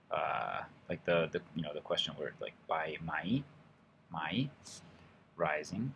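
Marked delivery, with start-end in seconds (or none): 2.7-5.9 mai dipping then rising; Thai rising tone. Shown as a demonstration. Teacher demonstrating the question word, rising tone. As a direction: let mai dip and climb at the end. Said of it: "then rising tone".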